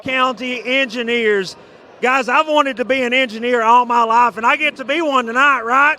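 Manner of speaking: loud rally-the-crowd host; hype announcer. Acted. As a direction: pump up the crowd, full volume. Hype host at the fan section.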